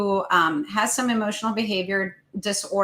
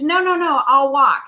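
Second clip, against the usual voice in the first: put-on higher, louder panicked child protest; mock child scream; 5 semitones higher, 7 dB louder than usual. Parent retelling how the boy yelled, loud mock child cry. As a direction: belt it out like a kid giving in fast. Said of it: "he screamed".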